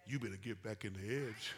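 lightly voiced low, quiet warning; inner warning voice. God-given inner voice warning a straying believer, low and quiet. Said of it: "here's what it'll say".

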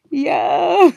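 high, loud laughing "yeah"; real giggling exclamation; through laughter. Real, not acted. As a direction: squeal it out mid-laugh. Exclaims amid laughing fit.

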